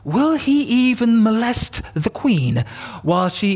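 exclaiming king. Acted. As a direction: blurt it in shocked indignation. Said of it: "The king exclaimed".

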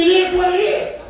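incredulous repeat of the ex; outraged echo. Acted. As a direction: throw her words back in disbelief.

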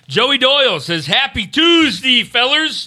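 slow, high, drawn-out shout-out; hype announcer read. Acted. Reads super chat in hype voice.

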